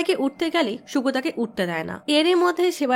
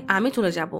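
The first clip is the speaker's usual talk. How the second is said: ordinary delivery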